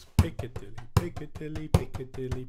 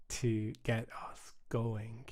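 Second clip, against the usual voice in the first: ordinary delivery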